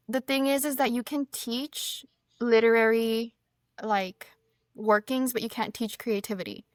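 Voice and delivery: ordinary talk, nothing marked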